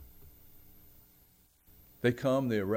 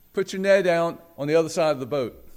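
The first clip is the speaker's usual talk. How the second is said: raised, louder instruction; projected voice, pitched up; calling out; 5 semitones higher, 7 dB louder than usual. Retold or imitated. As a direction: call directions out to a distant boat. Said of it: "he yells out there".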